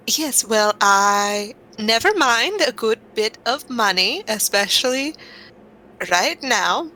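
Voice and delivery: lilting, swooping intonation; foppish adventurer in character. Acted. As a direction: introduce yourself with airy, chatty flourishes.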